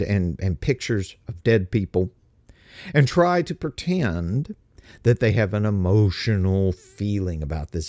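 usual speaking voice

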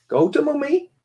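high, coaxing parent voice; gentle singsong daddy talk. Real, not acted. Sending child over to mother.

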